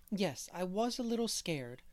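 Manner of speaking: a lightly voiced, proper little girl; prim little girl. A narrator's reading. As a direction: answer neatly and properly, like a good child. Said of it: "said Dora primly".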